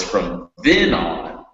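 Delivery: slowly, then drawn out and weighted; teacher models emphasis on then; stress on then. Shown as a demonstration. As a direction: lean on then in from then on. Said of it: "you need to emphasize that word".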